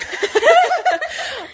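high squealing laugh; high burst of laughter. Real, not acted. Telling her friend she was starving, a high squealing laugh. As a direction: laugh out loud, high and free.

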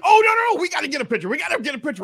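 high, excited fan begging for a selfie; eager fan impression. A fan at the conference insisting on a selfie, high and eager.

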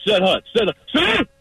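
lightly voiced high cadence; snap count demo. Shown as a demonstration. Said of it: "that's what it ends up sounding like".

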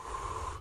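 long breathy blown-out breath; calming exhale. Retold or imitated. Helper at a crash scene, calming breath out. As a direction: blow out air to steady yourself. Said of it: "I'm just sitting there going".

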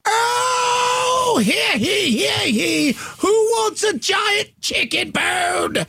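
hammed-up medieval town crier bellowing; mock herald crying out to the crowd. Hyping Renaissance Fair giveaway.

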